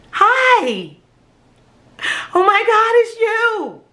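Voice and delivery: high, loud joyful gasp of recognition; delighted surprise. Retold or imitated. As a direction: greet a long-lost friend with sudden joy. Greeting herself in mirror.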